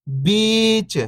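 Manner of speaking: long, stretched "बीच"; "बीच" with a sustained long ई; drawn-out vowel. Shown as a demonstration. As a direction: hold the ee in "बीच". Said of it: "खींच के पढ़ेंगे".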